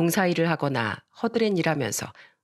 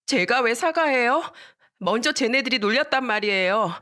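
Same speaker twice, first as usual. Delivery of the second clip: put-on high-pitched child's indignant back-talk; defiant protest; 5 semitones higher than usual. Girl refuses to apologize to friend's mother, defiant protest.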